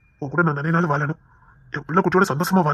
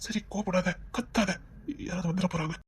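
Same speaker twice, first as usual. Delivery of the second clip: hushed pleading; 15 dB quieter than usual. Acted. Husband hushing wife.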